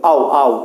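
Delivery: আও glided as a single sound, repeated; joined diphthong. Shown as a demonstration. Teacher isolating the glide for students, vowels joined. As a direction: slide আ into ও without a break in আও. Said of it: "উচ্চারণ করতে হবে এক নিঃশ্বাসে".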